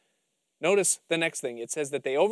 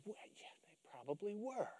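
whispered giving in; 4 semitones higher, 20 dB quieter than usual. Retold or imitated. The believer giving in to the lie.

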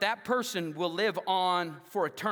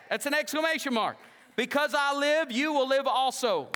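high, loud climactic preaching; preaching at full force; 6 semitones higher, 4 dB louder than usual. Acted. Pastor rousing the congregation with scripture, preaching loud and high. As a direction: drive each verse home to the church. Preacher builds to exclamation verses.

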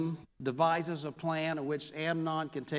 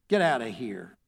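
low, menacing snarl; 6 semitones lower than usual. A narrator's reading. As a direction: spit the words out lower, with contempt. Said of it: "he snarled at her".

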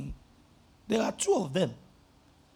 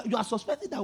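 ordinary talk

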